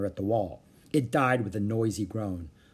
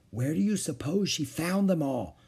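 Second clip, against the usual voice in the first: usual speaking voice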